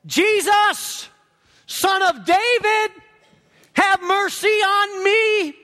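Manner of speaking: high, drawn-out shout; screechy loud cry. Acted. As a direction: cry out loudly for mercy, stretching each phrase. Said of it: "in a loud, screechy, commotion voice".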